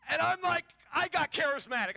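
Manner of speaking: ordinary talk, nothing marked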